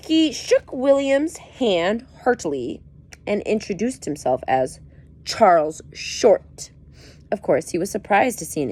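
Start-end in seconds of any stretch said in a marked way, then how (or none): 0.1-2.8 emphatic story narration. A narrator's reading. 5.2-6.7 slow emphatic name. A narrator's reading. Slow naming of character.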